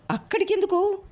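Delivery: sheepish feigned innocence; coy hesitant question. A narrator's reading. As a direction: play innocent, hedging the question. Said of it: "నంగి నంగిగా అన్నాడు లూ".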